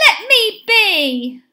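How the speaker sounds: high, loud, slow plea; shout. A narrator's reading. Benny to the hungry boys, slow high shout. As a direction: shout it with the words stretched out. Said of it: "shouted Benny".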